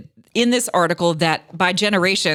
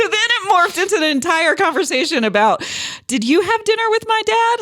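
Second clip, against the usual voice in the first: laughing, pitched up; 10 semitones higher than usual. Real, not acted. Host laughs while retelling the dad joke, laughing. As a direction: giggle through the retelling.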